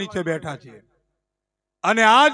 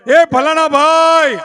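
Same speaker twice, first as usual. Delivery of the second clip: loud, drawn-out welcoming call across the lane; calling out a welcome; 5 semitones higher, 7 dB louder, slower than usual. Retold or imitated. Host calling to a guest coming down the lane, loud welcome. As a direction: hail the guest from afar, slowly.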